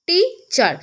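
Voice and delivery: Teacher split as টি, pause, চার; two-part syllable break. Shown as a demonstration. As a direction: stop once in the middle of teacher: টি... চার. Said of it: "একবার করে থেমেছি".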